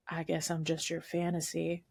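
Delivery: lightly mocking sultry line; low sultry murmur. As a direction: purr it like a smooth seducer. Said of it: "he just goes".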